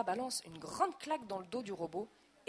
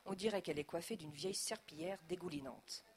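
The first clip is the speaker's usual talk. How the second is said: ordinary delivery, nothing marked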